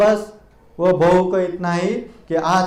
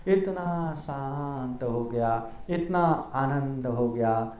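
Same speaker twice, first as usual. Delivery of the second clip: peaceful calm; 6 semitones lower, 10 dB quieter, slower than usual. A narrator's reading. The Brahmin grows peaceful.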